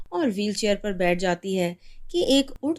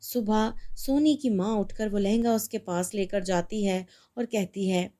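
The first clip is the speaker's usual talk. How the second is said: ordinary delivery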